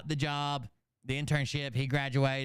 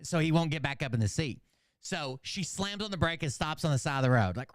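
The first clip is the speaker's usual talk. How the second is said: ordinary talk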